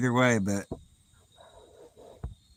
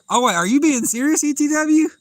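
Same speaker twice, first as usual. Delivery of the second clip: sudden surprise; 13 semitones higher, 7 dB louder than usual. Real, not acted. Realizes viewer is really shark fishing.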